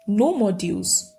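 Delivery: usual speaking voice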